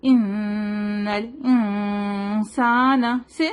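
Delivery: ان الانسان slow with noon nasal and hidden. Shown as a demonstration. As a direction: model ان الانسان, tongue off the palate. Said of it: "تو ہم کہیں گے". (ان الانسان میں اخفا)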